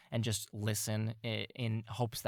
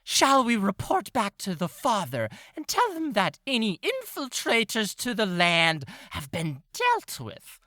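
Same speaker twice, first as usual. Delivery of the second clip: much higher, louder formal cat voice; pompous cat; 9 semitones higher, 6 dB louder than usual. Acted. Green-eyed cat proposes reporting to the father, high and grand. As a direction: speak grandly like a self-important sentry. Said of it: "the green-eyed cat goes".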